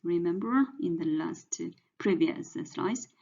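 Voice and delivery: usual speaking voice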